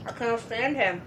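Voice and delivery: talking while chewing chips; mouth full. Real, not acted. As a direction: speak through a mouthful of chips. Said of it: "I'm munching on some chippies".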